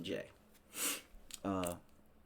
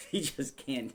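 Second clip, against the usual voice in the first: ordinary delivery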